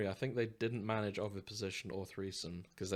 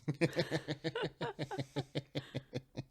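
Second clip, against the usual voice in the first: breathy giggle, higher pitched; high airy laugh; 5 semitones higher than usual. Real, not acted. Podcaster after reading a cheesy message, high airy laugh.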